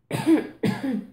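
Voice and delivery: short deliberate cough; demo cough. Shown as a demonstration. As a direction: give one clear cough. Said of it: "can you cough for me like this".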